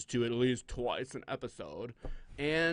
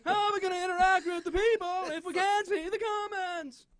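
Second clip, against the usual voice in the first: high, loud cartoonish voice; goofy put-on voice; 4 dB louder than usual. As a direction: ham it up in a silly high voice. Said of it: "That's really good, man".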